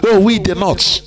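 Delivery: sarcastic, self-important "Oh, we the North"; mocking quote. Speaker mocks northern leaders, sarcastic lilt. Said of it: "they come and say".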